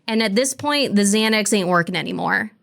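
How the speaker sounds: usual speaking voice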